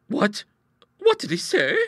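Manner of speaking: high, startled question; alarmed gasp. Acted. Bertha to her sister about the boy, gasping alarm. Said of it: "gasped Bertha".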